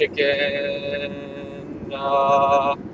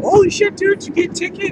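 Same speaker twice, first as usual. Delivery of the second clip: shocked friends. Retold or imitated. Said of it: "they're like".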